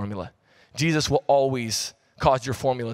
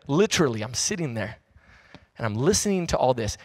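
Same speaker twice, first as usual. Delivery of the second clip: ordinary delivery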